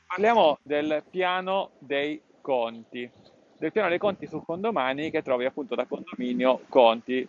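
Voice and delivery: normal talking voice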